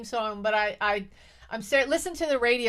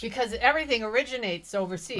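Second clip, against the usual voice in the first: normal talking voice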